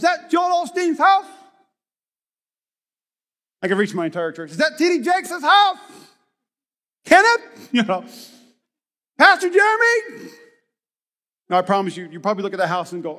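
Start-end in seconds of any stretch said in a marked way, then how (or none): 0.0-1.3 high and loud gawking question; excited mock shout. Believers in heaven spotting famous pastors' mansions, shouting excitedly. 4.5-7.7 high and loud gawking question; excited mock shout. Believers in heaven spotting famous pastors' mansions, shouting excitedly. 9.2-10.3 high and loud gawking question; excited mock shout. Believers in heaven spotting famous pastors' mansions, shouting excitedly.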